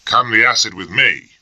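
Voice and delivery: a light mock of Bennett's rough colonial accent; half-hearted impression of Bennett's colonial accent. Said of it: "a fair approximation of Bennett's accent".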